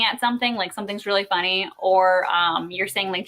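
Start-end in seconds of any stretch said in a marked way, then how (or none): none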